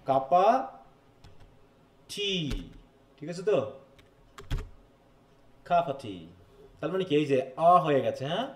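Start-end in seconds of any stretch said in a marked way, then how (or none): 0.0-2.3 "কাপা টি" with "of" reduced to a bare "a", drawn apart; elided "of". Shown as a demonstration. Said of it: "অফটা পুরো out হয়ে". 5.7-6.3 "কাপা টি" with "of" shrunk to "a"; elided "of". Shown as a demonstration. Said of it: "অফটা পুরো out হয়ে".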